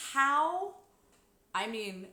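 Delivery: ordinary talk, nothing marked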